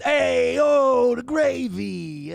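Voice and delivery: mock Italian-American accent. Streamer reading chat in mock Italian-American accent, slow gravy.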